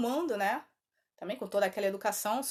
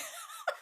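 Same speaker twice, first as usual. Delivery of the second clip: high, soft real laugh; amused laugh; 10 dB quieter than usual. Real, not acted. Storyteller amused by the well-behaved entity, laughing. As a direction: let out a high, quieter laugh. Laughs after the educadinha quote.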